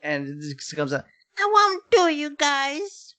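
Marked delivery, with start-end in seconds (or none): 1.4-3.1 put-on high, slow whiny kid voice; whining child voice. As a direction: whine it like an adult faking a child. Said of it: "That was a good impression".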